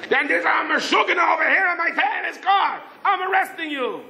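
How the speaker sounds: high-pitched angry rant; exasperated outburst. Retold or imitated. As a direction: snap back, voice way up. Patrolman loses patience.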